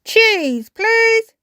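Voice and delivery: high, loud, hearty request; Grampy Rabbit. A narrator's reading. Grampy Rabbit orders from the shop, loud high request. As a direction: call the order out high and strong. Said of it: "Grampy Rabbit said".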